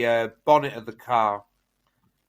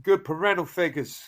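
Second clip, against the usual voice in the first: put-on Southern accent; 6 semitones higher than usual. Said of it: "that accent".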